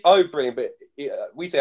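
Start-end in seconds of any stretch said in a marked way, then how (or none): 0.0-0.6 "Oh, brilliant" with a lightly imitated northern English accent, a bit louder; a hint of a northern accent, louder; Jodie Whittaker's northern accent. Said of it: "she's keeping the accent".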